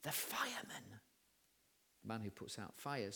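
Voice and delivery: tiny secretive whisper; little boy's whisper. Acted. Little boy answering who the policeman is with, whispered. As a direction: whisper it very softly, like a child. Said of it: "and the little voice says".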